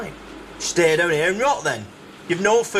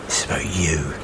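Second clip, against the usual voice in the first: low, half-whispered seething; bitter muttering; 9 semitones lower, slower than usual. Acted. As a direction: hiss it under your breath in anger. Official muttering bitterly after defeat.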